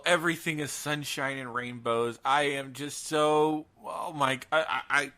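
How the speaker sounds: normal talking voice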